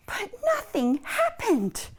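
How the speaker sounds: high, singsong storyteller's dismay; exaggerated disappointment for children; dramatic letdown. A narrator's reading. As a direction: swoop up high, then fall away. Pull fails in children's story.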